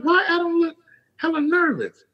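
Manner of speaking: high, mocking squeak; high teasing question.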